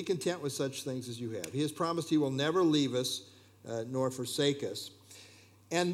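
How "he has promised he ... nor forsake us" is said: ordinary talk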